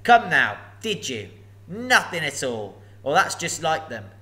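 a huge dragon toying with a thief; booming sly dragon; menacing dragon. Acted. Dragon speaks.

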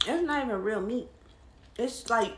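chewing while talking; talking around food; mouth full. Real, not acted. Seen 2: Speaker's closed, bulging mouth holds sandwich bite while rating it.

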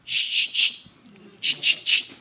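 put-on whispered shh like soothing a baby; soft shushing. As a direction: hush them like an infant. Mocks soothing weak preaching.

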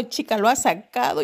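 normal talking voice

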